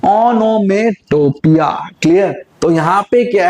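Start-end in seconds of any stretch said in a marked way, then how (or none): 0.0-1.9 slow, syllable by syllable: ओनोमेटोपिया; correct pronunciation. Shown as a demonstration. Teacher to class, modelling the word ओनोमेटोपिया slowly and clearly. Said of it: "इसकी जो correct pronunciation है वो है".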